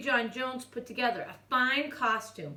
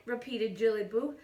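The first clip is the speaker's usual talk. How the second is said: ordinary delivery, nothing marked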